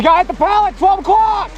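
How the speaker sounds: high, urgent enemy callout. Real, not acted. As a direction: shout the enemy spot. Calling out enemy.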